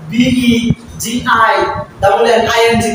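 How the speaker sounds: very slow letter-by-letter spelling; teacher spelling slowly for the class; spelled out slowly. Shown as a demonstration. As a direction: drag each letter of বিইজিআই out slowly. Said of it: "spelling out the word".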